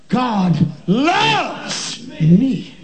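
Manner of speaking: "loves" soaring high and stretched out; very slow, swelling hard on "loves"; stress on loves. Shown as a demonstration. Preacher leading the church through the pattern, stress on "loves". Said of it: "each time emphasizing a different word".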